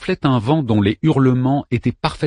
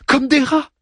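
panicked. Acted. Daeman panicking.

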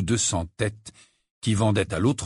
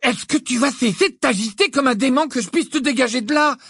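high, urgent raised voice; exasperated yell; 5 dB louder than usual. A narrator's reading. Ron to thrashing Harry as he untangles him, exasperated. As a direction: cry out in exasperation, pitched well up.

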